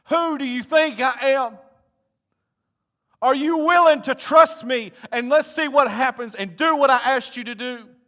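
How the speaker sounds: Jesus challenging Martha's faith, loud and slow; passionate challenge. Acted. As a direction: preach it at full force, stretching the words. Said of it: "This is what he's saying".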